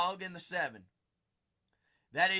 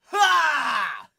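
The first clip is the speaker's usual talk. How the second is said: shouted laugh; 9 semitones higher, 16 dB louder than usual. Real, not acted. Reacting to card pull.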